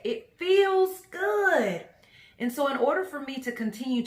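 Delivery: exuberant. Real, not acted. Telling viewers about the world she chooses, exuberant. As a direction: stretch each word way up and out, jubilant. Describing her chosen world joyfully.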